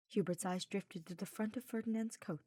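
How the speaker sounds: ordinary delivery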